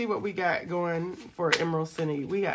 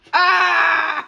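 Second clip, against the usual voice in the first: high, loud "Ah!" scream; frustrated scream; 9 semitones higher, 7 dB louder than usual. Real, not acted. Frustrated scream at getting an IOU.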